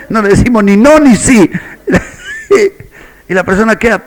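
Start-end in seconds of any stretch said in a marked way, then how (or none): 0.1-3.3 laughing. Real, not acted. Pastor laughs at evasive answers.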